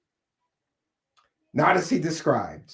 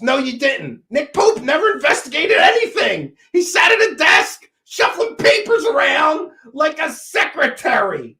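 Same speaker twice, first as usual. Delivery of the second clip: high, loud, scornful outburst; indignant, rising to a shout; angry rebuttal; 8 semitones higher, 5 dB louder than usual. Real, not acted. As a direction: shout down the false claim. Angry rebuttal of mimicked claim.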